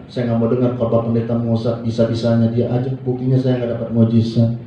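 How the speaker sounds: low, monotone complaint; mock disgruntled churchgoer; quitter's grumble. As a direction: grumble it low on one note, like someone giving up.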